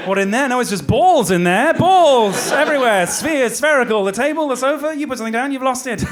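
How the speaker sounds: high, loud and laughing; hammed-up excuse. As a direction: babble an absurd excuse. Mock reply to fundraiser, laughing.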